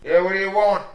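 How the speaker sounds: voice through the door. Acted. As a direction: demand it through a shut door. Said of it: "you hear the voice through the door".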